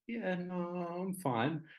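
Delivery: high, small retold "I'm fine"; acted attempt to cover up tears; holding back tears. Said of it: "trying to hold back tears".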